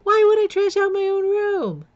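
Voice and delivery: shouting my innocence in disbelief; indignant outburst. Retold or imitated. To the cops accusing her, a loud high indignant protest. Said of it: "I'm like".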